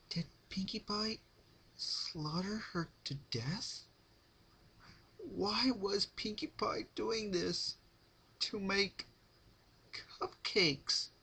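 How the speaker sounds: high, slow, fearful inner voice; Rarity's frightened thoughts. Acted. Rarity, captive, fearing what Pinkie did: high scared voice. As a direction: wonder in dread, high and slow.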